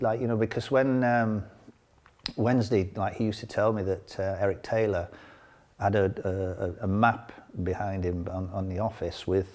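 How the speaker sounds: ordinary delivery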